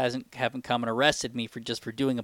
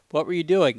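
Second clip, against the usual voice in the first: usual speaking voice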